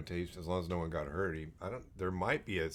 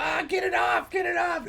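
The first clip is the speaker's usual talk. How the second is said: mock panicked shriek; 7 dB louder than usual. Mock panic about jumping spider.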